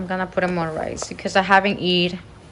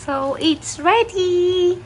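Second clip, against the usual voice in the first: ordinary talk, nothing marked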